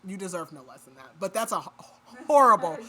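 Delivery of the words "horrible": horrible said loud and heavy; stressed horrible. Real, not acted. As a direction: lean hard on horrible, disgusted. Disgust at gruesome death.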